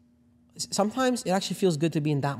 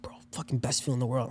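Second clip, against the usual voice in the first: imitating an intense, hushed whisper; retold whisper; 3 semitones lower, 8 dB quieter than usual. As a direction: whisper it like the roommate confiding a secret. Retold intense words of high roommate.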